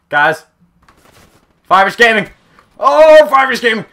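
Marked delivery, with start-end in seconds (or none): 1.7-3.8 high, loud self-hyping cry; excited catchphrase, very high and loud; hyped-up name shout. Real, not acted. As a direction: yell your own name to fire yourself up. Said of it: "every time I say it".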